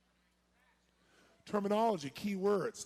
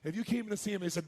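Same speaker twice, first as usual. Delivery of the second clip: usual speaking voice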